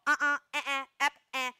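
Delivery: sharp correction noises. Shown as a demonstration. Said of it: "Anything along those lines, it's a noise".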